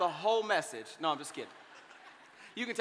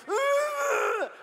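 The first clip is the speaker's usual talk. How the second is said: very high, loud grunt; mock baby shout of protest, very high; baby's shout ugh; 4 dB louder than usual. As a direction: shriek a baby's angry ugh. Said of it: "He goes".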